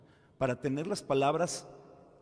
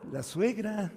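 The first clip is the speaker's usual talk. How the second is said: higher, slow delighted aside; eager priest; 7 semitones higher, slower than usual. Retold or imitated. Priest delighted the mother-in-law comes to confess, slow eager aside. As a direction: savour the coming gossip.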